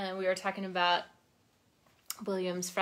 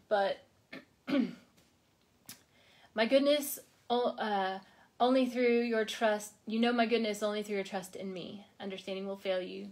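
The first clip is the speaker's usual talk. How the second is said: ordinary talk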